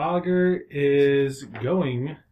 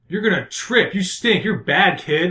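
high, loud playground-style taunts; mocking, raised witchy taunt; taunting curse; 6 semitones higher, 6 dB louder than usual. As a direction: jeer it loudly, pitched up.

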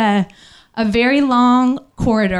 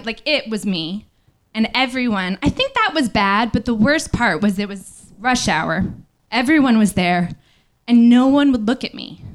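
normal talking voice

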